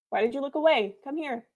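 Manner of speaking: ordinary talk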